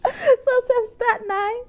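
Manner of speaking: high-pitched words squeezed through real laughter; helpless laughter. Real, not acted. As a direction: try to talk while cracking up. Cracking up at her own story.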